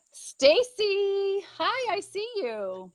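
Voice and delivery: high, bright hello; excited greeting. Real, not acted. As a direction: greet her with a lift of joy. Greeting guest joining the live.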